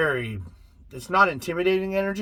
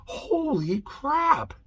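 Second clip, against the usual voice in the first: amazed; 8 semitones higher than usual. Pretend. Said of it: "You'll be like".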